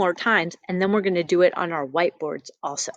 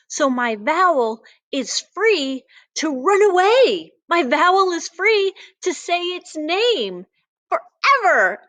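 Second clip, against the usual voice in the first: playful; 9 semitones higher, 3 dB louder than usual. Acted. Vowel runs free, playful teacher.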